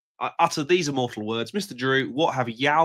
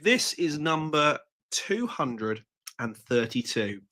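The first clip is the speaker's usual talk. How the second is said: ordinary talk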